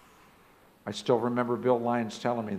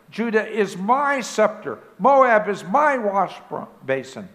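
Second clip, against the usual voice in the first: high, loud, slow triumphant proclamation, every claim ringing; with exultation; 8 semitones higher, 7 dB louder, slower than usual. Acted. Preacher voicing God claiming the nations, loud and exultant. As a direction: proclaim it like a king claiming his lands, in exultation. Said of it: "With exultation, God is speaking".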